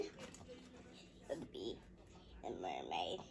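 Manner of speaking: hushed whisper. Pretend. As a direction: whisper it like a secret. Child drops to whisper.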